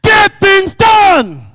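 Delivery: high, slow, shouted chant with full conviction. Shown as a demonstration. Speaker chants the slogan with conviction for the audience to echo. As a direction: hammer each word home like a billionaire. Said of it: "Let's say it one more time".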